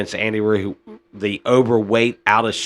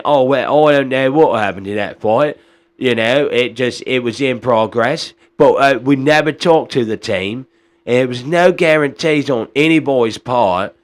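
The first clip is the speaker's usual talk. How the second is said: hammed-up mock British accent, a bit louder; Eddie Hearns British accent; 2 semitones higher, 4 dB louder than usual. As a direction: do a shaky British accent like a smooth-talking promoter. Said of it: "I don't know how bad my British accent was".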